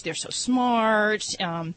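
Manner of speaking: ordinary talk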